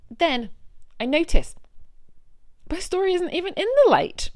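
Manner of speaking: story pulled, voice climbs in outrage; rising outrage. Real, not acted. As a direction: let the indignation climb. Discovering the story dropped.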